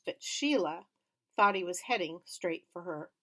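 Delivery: ordinary delivery, nothing marked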